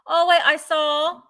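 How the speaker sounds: ordinary delivery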